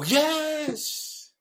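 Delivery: high, loud delighted yes; a bright, thrilled yes; eager yes. Shown as a demonstration. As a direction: enthusiastic yes. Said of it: "I hear you go".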